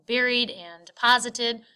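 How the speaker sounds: usual speaking voice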